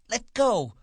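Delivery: very high, loud woman's cry. A narrator's reading. Wife trapped by his grip, crying out. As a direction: cry out in sharp alarm, way up high. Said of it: "she cried".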